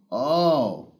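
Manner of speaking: ordinary talk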